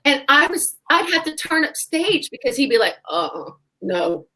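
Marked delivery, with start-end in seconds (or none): 3.1-4.3 imitating a deep-voiced chaperone. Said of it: "he sounded like James Earl Jones".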